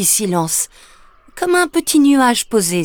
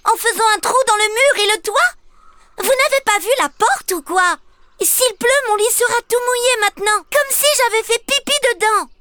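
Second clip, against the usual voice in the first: put-on high, fast indignant little girl scolding. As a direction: tell them off properly. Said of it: "Indignée, elle leur crie". (petite fille indignée qui gronde, voix aiguë)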